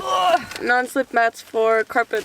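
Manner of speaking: normal talking voice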